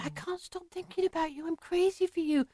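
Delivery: high, very soft imitated crying voice; mock-tearful confession, small and high. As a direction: plead softly, as if in tears. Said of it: "then she burst into tears".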